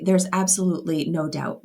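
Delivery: ordinary delivery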